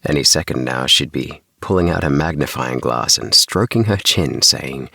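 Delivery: usual speaking voice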